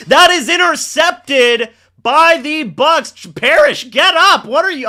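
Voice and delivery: loud, high, rising play call; excited shout. Real, not acted. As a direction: yell it as the pick happens. Live interception call, shouting.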